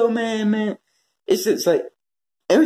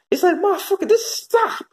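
exasperated plea; 12 semitones higher than usual. Real, not acted.

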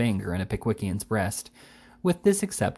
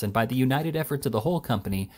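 ordinary delivery, nothing marked

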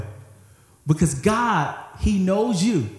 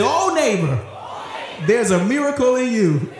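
high, laughing preacher call; preach to him; 7 semitones higher than usual. Shown as a demonstration. Preacher showing how to preach to a neighbor, higher and laughing. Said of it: "Come on, preach to him".

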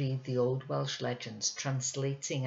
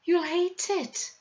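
warning; 6 dB louder, slower than usual. Acted. Boy warns girl, in tears.

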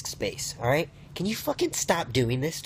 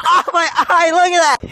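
high, loud yell of alarm; alarmed shout; 10 dB louder than usual. Acted. Crying out about their own eye, alarmed shout.

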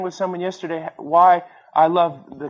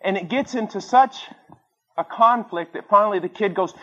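ordinary delivery

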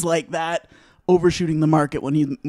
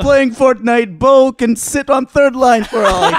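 higher, louder Russian-style hockey veteran; old European accent; 6 semitones higher, 4 dB louder than usual. Retold or imitated. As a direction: ham up the old Russian vet. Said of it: "my go-to old European hockey player accent".

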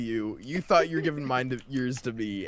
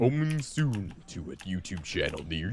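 lower, softer trailer announcer; movie trailer voice; 6 semitones lower, 5 dB quieter than usual. Pretend. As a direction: read it like a film teaser. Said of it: "you could do the cinematic voice for that too".

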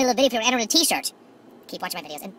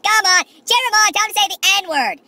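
high, loud, slow egging on; mock comedy host urging the crowd; imitating host; 10 semitones higher, 7 dB louder, slower than usual. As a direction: hype the crowd like an MC. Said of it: "He's like".

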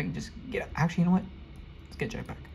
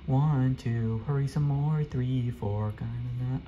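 ordinary delivery

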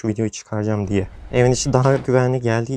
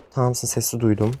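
usual speaking voice

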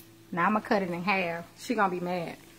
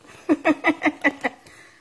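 laugh; 9 semitones higher, 10 dB louder than usual. Real, not acted. Laughs at her burger.

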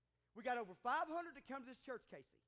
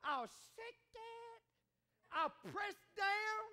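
slow, drawn-out, higher voice of God; 7 semitones higher, 7 dB louder, slower than usual. Acted. Preacher voicing God's promise to the givers, slow and exalted. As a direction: stretch each promise slowly like a proclamation. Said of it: "God says".

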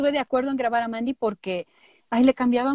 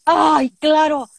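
sudden recognition; 5 semitones higher, 6 dB louder, much slower than usual. Retold or imitated.

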